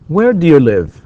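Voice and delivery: loud, high "Where", falling away to "live"; falling intonation. Shown as a demonstration. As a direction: let "Where do you live" drop at the end. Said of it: "it's falling intonation".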